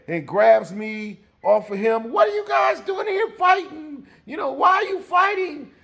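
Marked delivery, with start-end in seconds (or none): 2.1-5.7 high, loud scolding mother voice; shrill-pitched angry mom, loud. Retold or imitated. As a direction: shout at the boys in a high voice. Mother breaking up fight.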